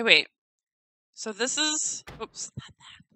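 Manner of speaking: ordinary delivery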